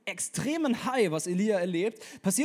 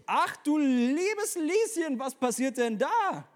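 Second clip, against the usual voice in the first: much higher, slow, hammed-up gasp; mock shock; 8 semitones higher, 3 dB louder, much slower than usual. Preacher reacts to the threat, high and slow. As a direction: play the shocked listener. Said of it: "mock shock".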